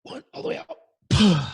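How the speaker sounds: loud, slow, drawn-out release; slow exhale with a count. Shown as a demonstration. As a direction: let the last of the air out slowly. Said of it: "let's try it".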